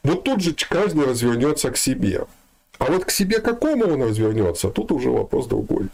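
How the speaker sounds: ordinary talk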